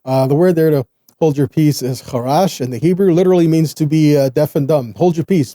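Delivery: normal talking voice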